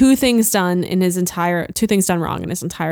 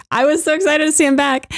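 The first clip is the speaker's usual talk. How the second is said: excited quote; 8 semitones higher than usual. Retold or imitated. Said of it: "I was like".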